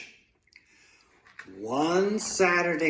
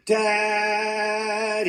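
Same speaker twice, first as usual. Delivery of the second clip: long, high, loud call; louder call; 8 semitones higher, 5 dB louder than usual. A narrator's reading. Boy trying to wake his father, louder drawn-out call. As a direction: call out higher, stretching both syllables. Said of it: "Luke said a little louder".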